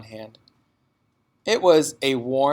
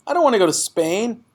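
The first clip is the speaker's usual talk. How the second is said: put-on high, loud pleading child's voice; a little girl protesting; child begging her father; upset child; 7 semitones higher, 4 dB louder than usual. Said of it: "Matilda cried".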